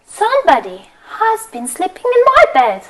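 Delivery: lightly lifted mama bear. Acted. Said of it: "Mama Bear says".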